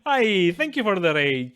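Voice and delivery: loud, slow, delighted hello; excited greeting. Real, not acted. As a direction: welcome the raiders with a loud cheer. Greeting a big incoming raid.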